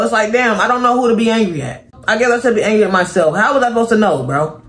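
ordinary talk

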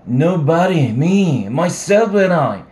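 put-on drunk shouting.

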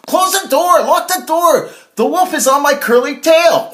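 very high, loud panicked squeal; panicked shout. Acted. Harold flees the wolf to his brother, shouting in panic. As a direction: yell in terror for the door. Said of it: "panting, said".

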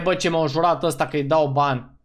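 ordinary talk, nothing marked

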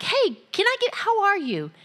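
friendly hey to someone sad; caring check-in. Retold or imitated. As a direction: reach out kindly. Greeting a sad person.

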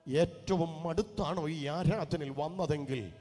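loud, high proclaiming crescendo; loud pulpit proclamation of healing; preaching at full force. Acted. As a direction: build into a fiery healing declaration. Preacher builds to prophetic proclamation.

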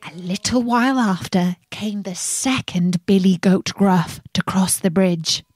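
plain reading voice

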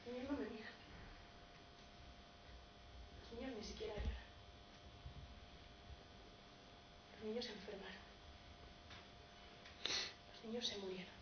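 hushed, sparse, sorrowful telling; very soft, with long silences between losses; grieving dramatic narration. Acted. Bereaved man narrates the loss.

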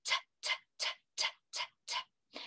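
voiceless "t" ticks, evenly spaced; crisp, quiet repeated "t"; ticking T sound. Shown as a demonstration. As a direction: tick "t" like a clock, no vowel. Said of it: "like a ticking clock".

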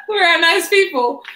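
cracking up mid-protest; laughing. Real, not acted. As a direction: giggle out the words after the slip. Laughing after stumbling on generally.

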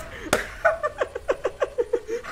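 high squealing laughter; real delighted giggle; high giggling laugh. Real, not acted. Laughter after taunt kill.

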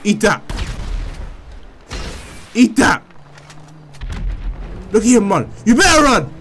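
shouted trash talk; loud gloating. Real, not acted. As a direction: gloat loudly at your enemy. Gloating while shooting enemy.

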